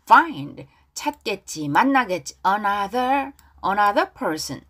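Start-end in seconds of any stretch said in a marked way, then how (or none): 0.0-0.7 "find" said louder with a clear F; one emphatic "find", English F at the front; F pronunciation. Shown as a demonstration. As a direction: stress the initial F of "find". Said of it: "F 발음".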